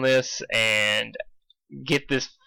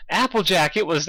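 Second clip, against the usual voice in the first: put-on Canadian accent; 6 semitones higher than usual. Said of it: "Canadian accent".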